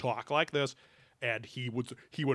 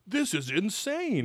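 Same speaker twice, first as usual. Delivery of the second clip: mock amazed scientist; 8 semitones higher, much slower than usual. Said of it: "paleontologist to be like".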